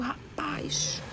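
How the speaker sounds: imitated hushed, whispered "rapaz"; sussurro de espanto. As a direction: whisper it to yourself, drawn out. Fala consigo mesma, preocupada. (sussurrado, como quem fala consigo)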